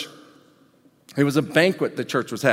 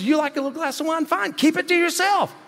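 higher, quicker, breezy paraphrase; Paul, casual; 11 semitones higher than usual. Retold or imitated. Paul advising wine drinkers, breezy and higher. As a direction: shrug it off like an easygoing apostle. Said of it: "Paul says".

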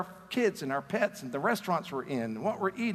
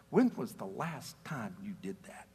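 hushed challenge; 8 semitones lower, 5 dB quieter than usual. Real, not acted. Pointed question to congregation.

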